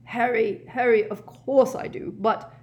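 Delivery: high, pleading protest; pleading old professor. A narrator's reading. Professor pleads with Harry, flustered. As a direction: plead his name, then trail into excuse.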